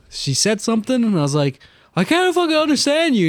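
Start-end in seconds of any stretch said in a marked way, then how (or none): 2.0-3.3 much higher mocking protest; retold high-pitched exasperation. Student retelling his complaint to the teacher, high and mocking. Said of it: "like I was trying to mock her".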